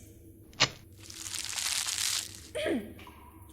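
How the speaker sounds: an embarrassed little ahem; awkward throat clear. Acted. Fox covers a slip in front of the spider with a throat clear.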